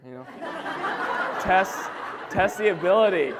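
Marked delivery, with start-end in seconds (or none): 1.4-3.3 louder, higher test call through the mic, half laughing; testing how far the voice carries from up front. Shown as a demonstration. Testing the mic before the family devotion, loud and giggly. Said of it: "how much power I had from up here".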